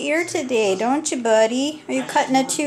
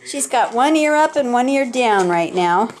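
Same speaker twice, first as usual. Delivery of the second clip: ordinary talk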